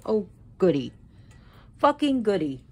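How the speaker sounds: loud, sarcastic mock delight; sarcastic exasperation. Real, not acted. Vlogger reacting to a mishap mid-recording, sarcastic exasperation. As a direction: exclaim it loudly with bitter irony.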